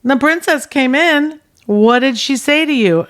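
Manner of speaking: rolling his eyes, fed up; exasperated. Retold or imitated. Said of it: "And he goes, "Ugh".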